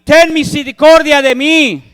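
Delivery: high, loud desperate cry; shouting beggar. A narrator's reading. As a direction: call out over the crowd. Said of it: "comenzó a gritar y decir".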